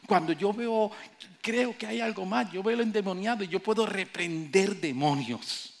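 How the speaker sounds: loud, high, rising preacher cry; fervent pulpit preaching; full-force preaching. Acted. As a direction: preach it out to the crowd. Preacher builds to a climax.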